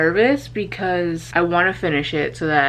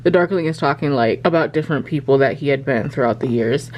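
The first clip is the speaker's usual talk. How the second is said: usual speaking voice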